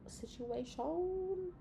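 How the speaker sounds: high, slow, playful mystic drawl; high drawn-out. Shown as a demonstration. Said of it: "drawn-out repeat".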